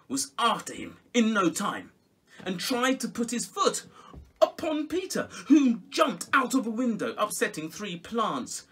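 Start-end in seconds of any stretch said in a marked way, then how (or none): none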